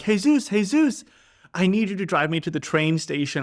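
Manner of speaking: high, urgent calling of his name; excited calling out. Retold or imitated. Running up to the neighbor, calling out excitedly. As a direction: call out to him like he's your rescue.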